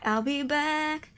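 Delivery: slow, drawn-out menacing promise; slow take. Acted. Killer signing off, slow drawn-out movie-villain line. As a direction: stretch it out slowly. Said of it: "movie line impression".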